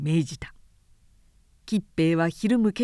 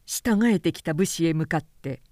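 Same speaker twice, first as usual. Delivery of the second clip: usual speaking voice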